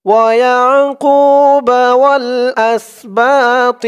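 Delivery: slow, high melodic tilawat; measured tajweed chant; Quran recitation. Acted. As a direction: chant the verse slowly and reverently.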